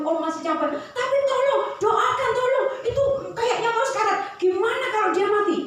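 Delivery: put-on a kid nagging until the pastor gives in; whining child. Child whining, pastor too tired.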